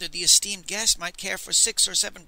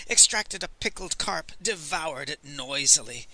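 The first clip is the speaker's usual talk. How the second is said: usual speaking voice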